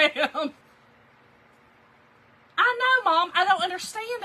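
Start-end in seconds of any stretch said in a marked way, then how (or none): 2.6-4.2 excited exclaim. Real, not acted. Reacting to viewers' comments.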